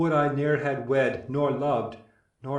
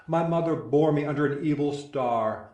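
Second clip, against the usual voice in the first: ordinary talk, nothing marked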